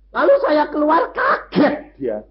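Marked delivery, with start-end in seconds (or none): none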